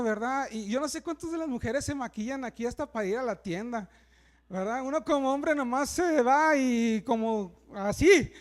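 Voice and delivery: ordinary talk, nothing marked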